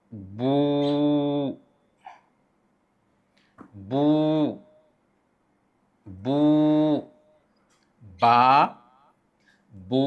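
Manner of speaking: बू stretched long each time; long vowel demo. Shown as a demonstration. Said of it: "ये हरकत तवीला हैं". (लंबी हरकत, बू खींचकर)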